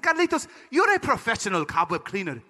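put-on firm advice, Panamanian accent; Panamanian father. Dad counselling his son about Al, accented admonition. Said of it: "He said".

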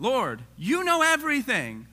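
high, loud, slow sarcastic reply; drawn-out sarcastic jab; smart aleck. Retold or imitated. Peter answering Jesus, high and sarcastic. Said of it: "He's getting a little smart aleck here".

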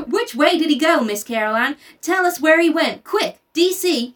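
put-on high, loud eager boy's voice; a lightly voiced eager southern boy; eager country boy. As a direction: urge the teacher to point out the mouse. Little Chuck eager in story.

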